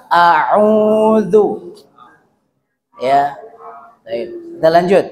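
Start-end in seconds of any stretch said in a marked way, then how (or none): 0.1-1.8 a'udzu spelt out slowly; slow correct reading. Shown as a demonstration. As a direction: lay out a'udzu slowly for learners. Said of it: "Jadi jangan dibaca".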